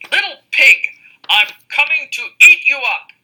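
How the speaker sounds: loud, high shout down the chimney; wolf shouting. Acted. Angry wolf shouting down the chimney at the pig. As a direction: bellow the threat from the roof. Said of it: "he shouted down the chimney".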